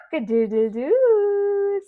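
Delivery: high, loud crow with a long final note; rooster crowing. Shown as a demonstration. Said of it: "What does a rooster say?".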